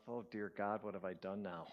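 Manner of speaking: low, soft private dread; thinking to oneself. Retold or imitated. Reluctant man after removing his shoes, thinking to himself. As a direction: murmur a regret to yourself. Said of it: "thinking to myself".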